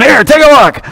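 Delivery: a casual, high mock voice of Jesus showing his wounds. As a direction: toss it off lightly, as Jesus might. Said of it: "you know, going".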